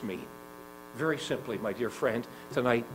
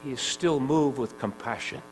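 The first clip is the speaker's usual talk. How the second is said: higher and louder, building fervor; preaching at full force; 6 semitones higher, 11 dB louder than usual. Acted. Preacher pleading with the listener, rising to full force. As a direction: drive it home to the congregation.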